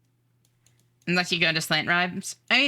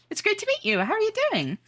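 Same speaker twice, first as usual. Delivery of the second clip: bright high-pitched streamer greeting, much higher than usual; faster than usual. Acted. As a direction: chirp a cheerful hello to chat in a high voice. Greets new viewer high voice.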